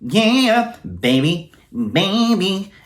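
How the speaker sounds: put-on high, nasal nineties boy-band singing. Shown as a demonstration. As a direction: push the sound into the nose like a boy band. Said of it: "It's like a very nasally sound".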